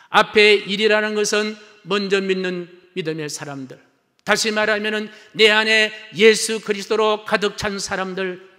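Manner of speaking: high, loud pulpit proclamation; ringing, urgent sermon delivery; full-force preaching. Real, not acted. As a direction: proclaim it to the whole congregation.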